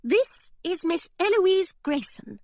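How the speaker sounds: high, slow, very proper introduction; finishing school voice. Acted. Hilary introducing her governess in her best finishing-school manner. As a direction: present her like a well-trained pupil. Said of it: "in her best finishing school voice".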